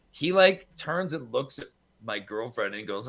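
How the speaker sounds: usual speaking voice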